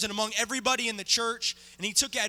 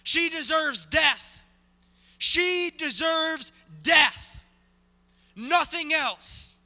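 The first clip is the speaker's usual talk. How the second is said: slow, louder demand for death, higher; crowd yelling; 3 semitones higher, 3 dB louder, slower than usual. Acted. Preacher voicing the crowd demanding the stoning, slow angry yell. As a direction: chant it like a mob calling for stoning.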